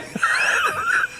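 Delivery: high shrieking laugh; hysterical laugh. Real, not acted. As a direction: burst into helpless shrieking laughter. Cracks up at the post.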